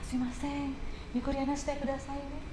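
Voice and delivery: soft, courteous request in Japanese; polite Japanese waiter. Retold or imitated. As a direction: gently ask the guests to quiet down. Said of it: "waiternya nyamperin ke kita".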